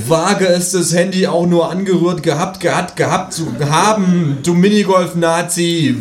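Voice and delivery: high, loud comic threat; threatening the kangaroo in a made-up tense; mock threat yell. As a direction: bark the threat with laughter in it. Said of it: "würde ich geschrien".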